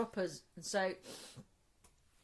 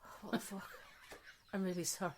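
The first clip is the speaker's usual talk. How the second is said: embarrassed mutter; 9 dB quieter, faster than usual. Retold or imitated. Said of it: "looked at Natalia like".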